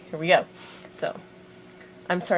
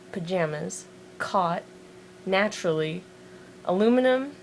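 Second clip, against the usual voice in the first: ordinary talk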